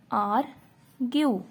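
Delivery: Argue drawn out slowly, syllable by syllable; slow demo word. Shown as a demonstration. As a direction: stretch argue slowly for the class. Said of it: "और जैसे कि example". (Argue को धीरे-धीरे खींचकर बोलो)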